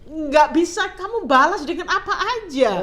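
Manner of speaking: a testimony bursting with joy; fervent excitement. Real, not acted. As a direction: swell with awe at God's greatness. Fervent praise of God's greatness.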